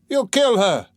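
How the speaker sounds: high, loud accusing cry; shout. Acted. Third onlooker shouting at Mikolka, loud high accusing cry. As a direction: fling it at him in alarm. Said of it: "shouted the third".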